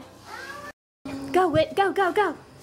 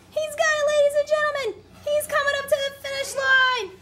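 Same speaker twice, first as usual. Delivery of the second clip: playful sports commentator, higher and excited; mock race announcer; 8 semitones higher than usual. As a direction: call it like a finish-line race.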